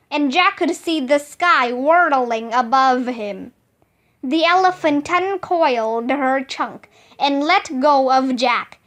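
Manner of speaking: ordinary delivery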